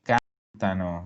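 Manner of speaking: cantano slowly with the weight on can, not on ano; stress on can. Shown as a demonstration. Teacher modeling the stress for the class, slow and clear. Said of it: "Si no puede cargar el acento en la terminación".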